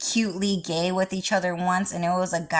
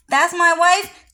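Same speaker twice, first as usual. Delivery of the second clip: "That's my wife" said high and loud with a rising end; excited boast; 9 semitones higher, 6 dB louder than usual. Acted. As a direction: proudly call it out, voice lifting on "wife". Repeated boast, rising take.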